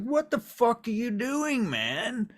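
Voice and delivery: high, loud yell of disbelief; shrieked, incredulous protest; horrified outburst. Acted. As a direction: cry out in alarm at something appalling. Horrified host sees wrist being cut.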